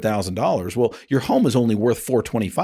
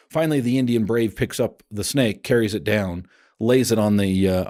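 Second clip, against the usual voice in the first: ordinary talk, nothing marked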